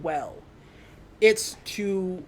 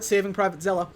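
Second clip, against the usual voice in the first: ordinary talk, nothing marked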